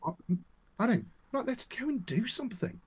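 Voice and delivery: planning to film at the show, eager and high; eager idea. Retold or imitated. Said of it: "And then I thought".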